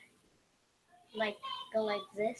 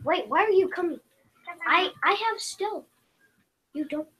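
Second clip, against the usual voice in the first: louder and higher, half-laughing protest; exasperated outburst; 7 semitones higher, 9 dB louder than usual. Real, not acted. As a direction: call out the friend ruining the stealth. Player scolds ally breaking stealth.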